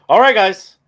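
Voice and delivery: big hype greeting to open the show; loud greeting. Real, not acted. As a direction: call out to the guys. Host opening the show.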